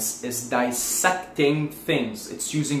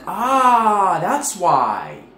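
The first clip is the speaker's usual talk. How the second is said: dawning realization; 4 semitones higher, 4 dB louder, much slower than usual. Pretend. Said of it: "And I'm like".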